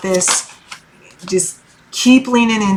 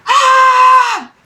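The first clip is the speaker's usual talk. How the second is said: a short, high, loud burst of a scream; playful scream; 6 dB louder than usual. Retold or imitated. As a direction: let out a silly, sudden yell for fun. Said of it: "And I'd be like".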